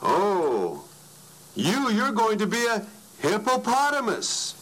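high, half-loud mock pronouncement; retold loud proclamation. Prophet announcing his fate, mock loud and high. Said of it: "in a very loud voice".

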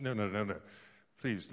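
ordinary talk, nothing marked

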